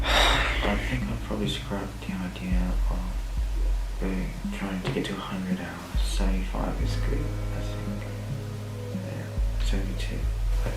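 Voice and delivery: exhausted hushed whisper; barely awake, whisper it; tired whisper. Real, not acted. Exhausted near end of challenge.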